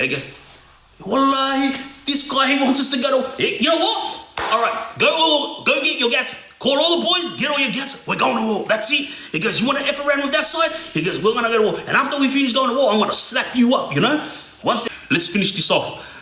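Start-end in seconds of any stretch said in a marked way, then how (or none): 1.0-9.1 retold fury. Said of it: "went so hard". 9.6-10.7 mock fast threat. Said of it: "went so hard". 11.2-14.2 mock threat. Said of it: "went so hard".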